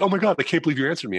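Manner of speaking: high, quick gushing; mock breathless excitement; starstruck fan. Online writer amazed at a reply.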